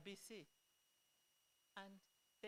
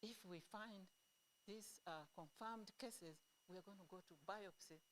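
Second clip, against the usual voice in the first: hushed, softer retelling of a confidence; retold whisper; 9 dB quieter than usual. Doctor confides a plan to [name], retold in a hushed voice. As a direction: pass it on quietly, lightly imitating a whisper. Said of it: "he just whispered to me".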